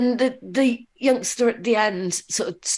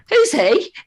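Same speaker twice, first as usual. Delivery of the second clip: put-on high, loud child's pipe-up; kid's blunt question; 9 semitones higher, 6 dB louder than usual. Pupil asking about the speaker's husband in the background, blunt and high. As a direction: blurt it out high and loud like a schoolkid.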